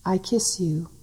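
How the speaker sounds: unhurried tender murmur with long pauses; hushed devotional reading. Acted. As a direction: breathe each line gently like a loving presence. Intimate channelled divine voice, softer.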